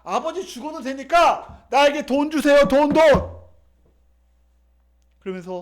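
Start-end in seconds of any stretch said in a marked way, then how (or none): 0.0-3.4 loud, greedy demand; demanding son. Acted. Prodigal son demanding his father's money, loud and insistent. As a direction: push harder and louder for the money.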